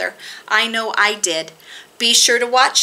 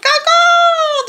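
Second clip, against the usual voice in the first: high, loud, long caws; croaking crow caws; 7 dB louder than usual. A narrator's reading. Narrator voices crows disturbing Owl, high loud caws. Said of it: "The crows croaked".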